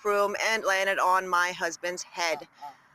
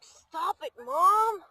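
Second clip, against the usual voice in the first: put-on high whiny child voice; whiny kid voice for the pet; 9 semitones higher, much slower than usual. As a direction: whine like a stubborn little kid. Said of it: "She's like".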